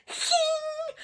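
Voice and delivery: high squeaky whimpering "hing"; mock anxiety whimper, very high and soft; anxious whimper. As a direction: squeak out a tiny panicked whine. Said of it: "my anxiety will be like".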